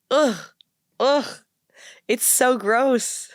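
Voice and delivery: real disgust, high and drawn out; grossed out. Real, not acted. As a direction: recoil with revulsion, stretching the words. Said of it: "it's, like, actually grossing me out".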